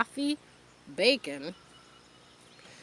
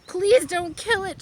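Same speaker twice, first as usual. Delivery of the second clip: put-on high, loud, slowed child's plea; a girl pleading for a piglet's life, tearful; sobbing plea; 10 semitones higher, 7 dB louder than usual. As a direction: beg him through tears, slowly. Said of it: "she sobbed".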